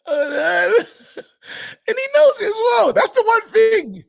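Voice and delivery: very high squeals between laughs; helpless laughing fit; crying with laughter. Real, not acted. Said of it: "laughing hard".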